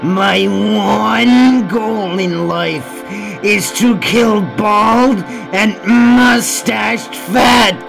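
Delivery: menacing declaration. Acted. Prisoner declares a killing obsession.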